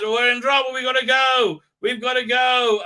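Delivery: hammed-up panic to get going; urgent shout. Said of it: "So he turns up and says".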